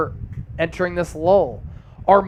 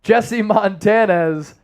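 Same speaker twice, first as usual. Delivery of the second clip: ordinary delivery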